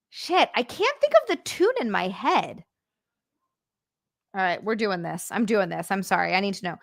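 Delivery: ordinary delivery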